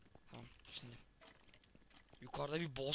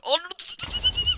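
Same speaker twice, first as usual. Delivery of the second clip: very high, loud shrieked curse; shrieking curse; 11 dB louder than usual. Real, not acted. Cursing at the enemy as it explodes. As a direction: shriek it as the fight goes wrong. Shouting mid game fight.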